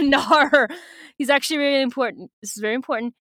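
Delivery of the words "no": louder, hammed-up old man's no; grandfather. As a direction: draw out the refusal in a put-on accent. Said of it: "Yami's grandfather's like".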